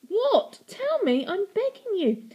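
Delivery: high, loud desperate begging. A narrator's reading. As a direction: beg frantically. Begging girl.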